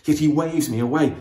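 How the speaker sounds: usual speaking voice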